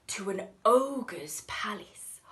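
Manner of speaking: ordinary delivery, nothing marked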